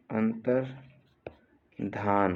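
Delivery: "अंतर्धान" slow, long gap after अंतर; split slowly for dictation. Shown as a demonstration. Teacher dictating a spelling, अंतर्धान slowly in two parts. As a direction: break "अंतर्धान" into अंतर, pause, धान.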